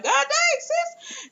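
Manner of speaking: high, squeaky mock disbelief; shocked reaction. Acted shocked reaction to yelling.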